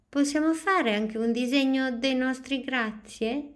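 put-on a small girl eager to draw; eager child voice. A narrator's reading. Noemi asks the teacher about drawings, high and eager.

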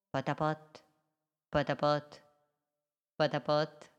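chug like a tiny toy boat; putt-putt sound. A narrator's reading. Tiny boat puttering across the sea. Little boat's engine sound.

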